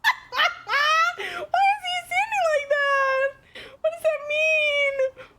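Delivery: very high, helpless laughing squeal; laughing shriek. Real, not acted. Streamer cracking up at a game character, laughing shriek. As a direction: squeal the question through laughter.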